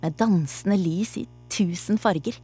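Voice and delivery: plain reading voice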